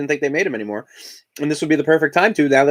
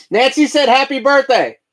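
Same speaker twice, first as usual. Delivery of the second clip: calling out; 11 semitones higher, 4 dB louder, slower than usual. Real, not acted. Repeating shout to child.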